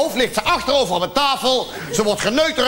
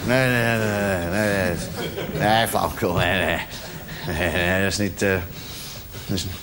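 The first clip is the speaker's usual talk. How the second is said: self-denial; 14 semitones lower, 5 dB quieter than usual. Acted. Talking himself out of suspicion.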